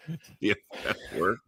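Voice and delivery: usual speaking voice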